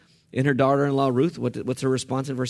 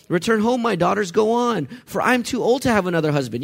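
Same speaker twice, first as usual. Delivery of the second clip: urging Naomi; 7 semitones higher, 3 dB louder than usual. Acted. Naomi voiced urging daughters home.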